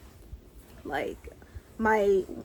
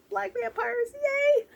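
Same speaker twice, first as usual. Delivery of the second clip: much higher, gleeful squeal; squealing cheer; slower than usual. Real, not acted. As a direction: squeal it with delight.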